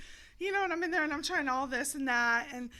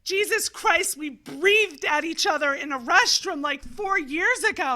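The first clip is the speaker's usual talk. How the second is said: exasperated; 3 semitones higher, 8 dB louder, slower than usual. Retold or imitated. Retold exasperated thought.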